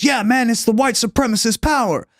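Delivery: high, put-on laid-back hippie voice; imitated hippie. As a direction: caricature a mellow hippie on a talk show.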